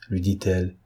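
plain reading voice

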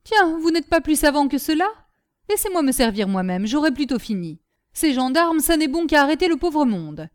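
loud, grumbling put-down; cantankerous scolding. A narrator's reading. Nanon refusing the gendarme's help, crotchety. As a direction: belittle him crossly.

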